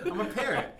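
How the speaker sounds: high laughing squeal. Real, not acted. Laughing self-mockery.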